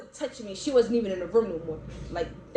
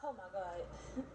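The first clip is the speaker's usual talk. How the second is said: worn-out murmur; 3 semitones lower, 14 dB quieter than usual. Retold or imitated. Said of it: "I'm like".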